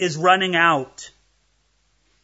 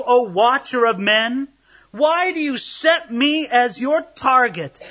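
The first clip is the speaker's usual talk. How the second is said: dramatic scripture reading; 3 semitones higher, 4 dB louder than usual. Acted. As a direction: read Job's plea with mounting anguish. Reads scripture verses.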